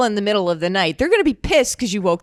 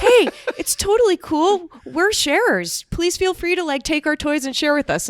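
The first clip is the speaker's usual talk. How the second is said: sweet angel voice; 8 semitones higher than usual. Retold or imitated. A dad in a bush invites a toddler to share, sweet angel voice. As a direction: coax like a gentle angel offering toys. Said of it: "voice of an angel, this really sweet, nice, uplifting voice".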